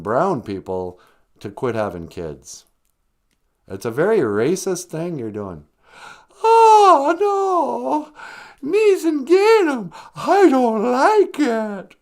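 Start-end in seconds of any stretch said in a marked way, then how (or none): 6.4-12.0 very high, loud, drawn-out wail; squeaky sidekick character. Acted. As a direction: whine it slowly in a piercing little character voice. Sidekick character parrots the taught phrase.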